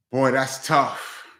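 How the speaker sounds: ordinary talk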